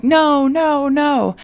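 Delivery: high, mild mock wail; retold scream. Host retelling wife hearing of husband's death, mock scream. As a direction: imitate a distraught scream without full volume. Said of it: "she's just screaming".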